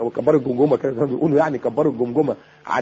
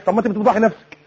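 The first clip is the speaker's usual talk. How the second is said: ordinary talk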